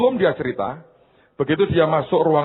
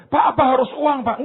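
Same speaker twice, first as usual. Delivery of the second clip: high-pitched congregant querying the pastor; hammed-up high query; mock worried question; 12 semitones higher than usual. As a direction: ask it high, in playful imitation of a worried listener. Acts out congregant's anxious question.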